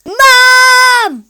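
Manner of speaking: very high, loud yell; yelling for mum. Acted. Boy yelling for mum to trick her. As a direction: bellow for Mum up the stairs. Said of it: "yelled Kipper".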